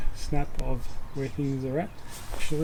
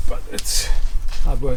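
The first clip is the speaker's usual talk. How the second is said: straining with effort while talking; effort grunt; 7 dB louder than usual. Real, not acted. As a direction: grunt through the hard work. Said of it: "sorry about the grunting".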